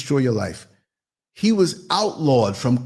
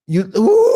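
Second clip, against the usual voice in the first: loud high hammed-up ooh into laughter; mock climax cry; 5 dB louder than usual. Acting out the silverback climax for the hosts, loud mock cry. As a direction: ham up the climax then crack up.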